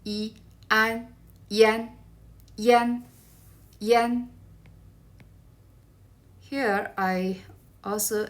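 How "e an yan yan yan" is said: "Yan" as Mandarin yān, level first tone, said slowly three times; pinyin yan demo. Shown as a demonstration. Teacher sounding out "Yan" for students, level tone. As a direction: spell out "E, An" then say "Yan" level and steady. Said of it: "It pronounces as".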